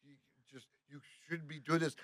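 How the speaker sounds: very soft imitation of a worried man; hushed concern. French guy cautioning the winded driver, hushed and concerned. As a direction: caution him almost under your breath.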